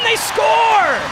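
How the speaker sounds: goal call. Real, not acted.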